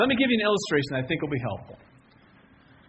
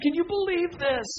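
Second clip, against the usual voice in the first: high-pitched imitation of amazement; amazed; 12 semitones higher than usual. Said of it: "you're gonna be amazed".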